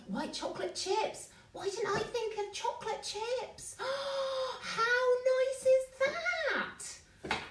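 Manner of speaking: high-pitched thrilled squeal, drawing out the last phrase; delighted gasping excitement. Real, not acted. Host thrilled by a viewer's chocolate chip idea, excited and high. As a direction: gush in high, amazed delight.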